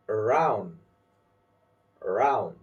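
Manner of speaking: "around" as a-ROUND, falling; weak "a", stressed ROUND in "around"; stress on second syllable. Shown as a demonstration. Teacher saying words for learners to repeat, second syllable stressed. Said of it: "I will say the word and you repeat".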